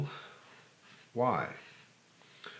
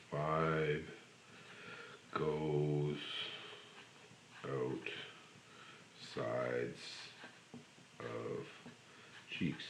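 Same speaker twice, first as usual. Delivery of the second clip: slow, each word its own beat, fading much quieter and lower; slow instructional cue fading to a murmur; 3 semitones lower, 9 dB quieter, much slower than usual. Shown as a demonstration. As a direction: spell out each word slowly as it dies away. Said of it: "slow step-by-step demo".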